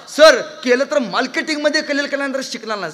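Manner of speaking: loud, high protest with R as L in "कलिअल"; mimicked speech defect, "कलिअल" for career; lisping boy. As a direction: insist loudly, saying L for R in "कलिअल". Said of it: "बेनाला र बोलता येत नव्हतं".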